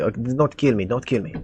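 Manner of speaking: put-on light Russian accent, softly pleading. Captive cultist begging the party for mercy, Russian accent. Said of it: "he says it with a bit of an accent, actually".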